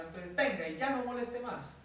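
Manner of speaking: put-on losing it with the baby, sharp and loud; stressed mother lashing out at her crying baby; angry mother.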